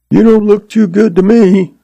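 put-on high-pitched cheeky kid; boy cutting in. A narrator's reading. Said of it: "the boy Jack cut in".

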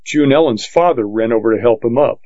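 ordinary delivery, nothing marked

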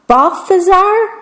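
high hopeful guess. A narrator's reading. Queen guessing.

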